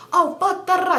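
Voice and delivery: high gibberish retort; mock combative comeback. Girl banging back at him, mock combative. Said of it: "She was really deep in them trenches".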